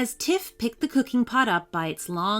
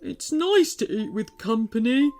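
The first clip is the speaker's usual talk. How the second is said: tearful, higher troll voice; cried; 4 semitones higher than usual. Acted. The troll tells the girls he would have shared, tearful troll voice. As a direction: plead it through tears.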